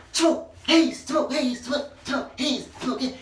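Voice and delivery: high, strained mimicry; mimicking the singer. Reviewer showing what he sounds like, high mimicry. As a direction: squeeze out a high imitation of a singer. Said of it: "That's what he sounds like".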